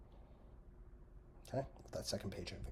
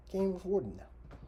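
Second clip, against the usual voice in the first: higher, louder complaint; exasperated cry; indignant outburst; 9 semitones higher, 6 dB louder than usual. Real, not acted.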